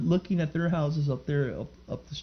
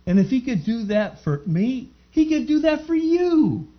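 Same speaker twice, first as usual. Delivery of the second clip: rising preaching call; 11 semitones higher, 5 dB louder than usual. Acted. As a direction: pitch climbs high and loud toward the amen. Preacher calls for amen.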